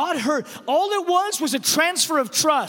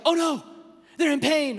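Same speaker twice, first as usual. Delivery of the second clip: acted alarm, raised pitch; 3 semitones higher than usual. Mother recognising her child's scream of pain, alarmed.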